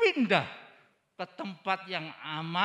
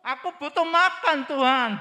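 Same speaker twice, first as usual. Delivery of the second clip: loud, higher, pleading yell; mock shouted prayer; 9 semitones higher, 7 dB louder than usual. Preacher imitating a hungry believer shouting at God, loud mock plea. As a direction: belt it out like a child demanding food. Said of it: "Tidak perlu teriak-teriak".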